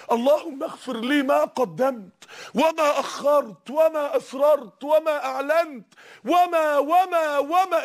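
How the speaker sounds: slow, rising supplication trailing off; slow humble prayer. Retold or imitated. The Prophet prays to God for forgiveness, slow rising supplication. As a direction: pray it slowly, voice climbing on the repeats. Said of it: "كان بيقول لربنا".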